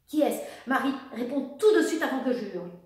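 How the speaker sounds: cold, loud demand; mother demands an answer, coldly and loud; icy demand. A narrator's reading. Mother demands the name from her daughter, icy and loud. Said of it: "s'exclama Sophie d'une voix glacée".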